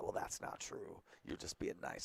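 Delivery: soft, slow murmur to oneself; retold private thought. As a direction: voice an unspoken doubt under the breath. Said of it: "us thought".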